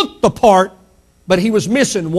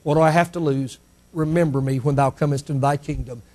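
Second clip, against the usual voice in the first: low, soft, resigned plea; quiet plea; 7 semitones lower, 8 dB quieter, faster than usual. Retold or imitated. Dying thief with nothing to lose, quiet plea. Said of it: "he just said".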